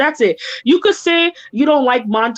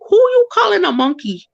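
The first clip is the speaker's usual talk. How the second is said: higher, sassy retort at him; mock-outraged higher comeback; indignant comeback; 7 semitones higher than usual. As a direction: fire it back, offended. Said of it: "I was like".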